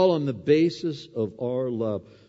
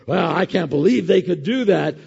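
judgmental; 7 semitones higher, 10 dB louder than usual. Pretend. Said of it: "and go".

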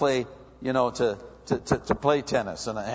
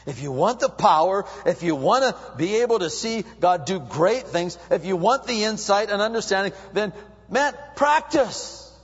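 building louder and higher to a high final word; rising exhortation; 6 semitones higher, 4 dB louder than usual. Real, not acted. Preacher urging the congregation toward prayer. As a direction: build to a cry on practice.

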